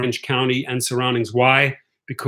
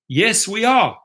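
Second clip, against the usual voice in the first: higher-pitched mock Scottish reply; Scottish passengers; 7 semitones higher, slower than usual. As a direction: answer as a passenger from Scotland, accent put on. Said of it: "from Britain or from Scotland".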